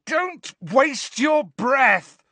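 high, slow, forceful yell. A narrator's reading. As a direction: yell over the screaming. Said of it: "yelled Harry".

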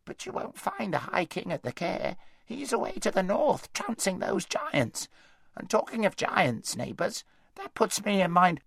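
high, wheezy chatty hedgehog; an unhurried gossiping hedgehog. A narrator's reading.

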